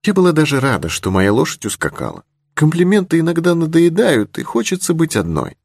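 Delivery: put-on high, artless young lady's voice; charming simplicity. A narrator's reading. Girl confessing she likes being alone, charmingly simple. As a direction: confide it simply, pitched well up. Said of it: "с очаровательной простотой".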